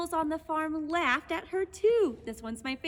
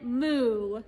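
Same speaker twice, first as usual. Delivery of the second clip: cow moo; 5 semitones lower, 5 dB louder than usual. Acted. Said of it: "finally went".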